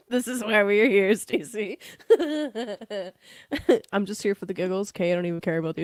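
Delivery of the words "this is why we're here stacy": laughing. Real, not acted.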